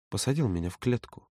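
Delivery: soft, subdued accusation. A narrator's reading. Юра accusing Володя of caging him, hushed. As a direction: answer the outburst very quietly. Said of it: "Чем тише говорил Юра".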